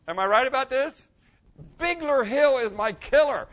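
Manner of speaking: preacher rallying the room, loud and high; excited call-out. Real, not acted. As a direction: throw it out to the whole congregation. Preacher calling out to the congregation.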